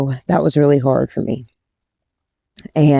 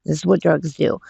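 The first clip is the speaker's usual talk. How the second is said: ordinary talk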